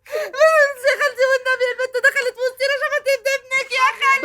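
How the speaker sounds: weepy appeal to aunt; tearful pleading. Acted. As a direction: beg her aunt through sobs.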